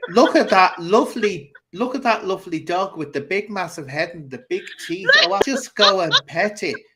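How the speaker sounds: high, loud mock-innocent delight; naive animal lover. Host playing someone admiring a dangerous dog, mock-naive delight. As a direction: coo over the dog, hammed-up and oblivious.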